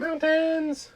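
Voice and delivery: high excited squeal of delight. Pretend. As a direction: squeal it out with glee. Playful reaction to card.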